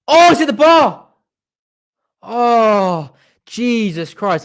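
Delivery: high, loud cry as the ball hits the bar; a near miss that has the fan on his feet; excited outburst. Real, not acted. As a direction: gasp it out in disbelief.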